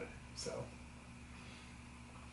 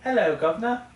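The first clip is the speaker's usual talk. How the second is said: higher-pitched mock English accent; 7 semitones higher than usual. Shown as a demonstration. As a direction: put on a cheeky English accent. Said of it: "let's go with English".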